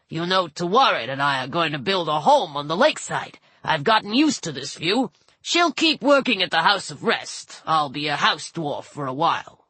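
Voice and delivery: a hint of a gruff dwarf; gruff dwarf god. A narrator's reading. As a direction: talk like a contented old dwarf. Bess the dwarf god speaks.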